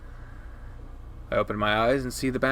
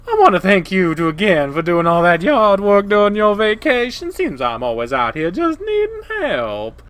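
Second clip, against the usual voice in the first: blaring, higher-pitched coyote woman; loud, obnoxious Janice; 7 semitones higher, 7 dB louder than usual. Acted. Janice turns her attention on the two boys, loud and obnoxious. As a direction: project it brashly.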